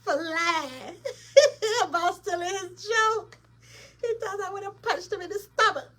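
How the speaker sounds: high, loud, talking through laughter; can barely get it out for laughing. Real, not acted. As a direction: crack up while retelling it. Retelling a joke, cracking up.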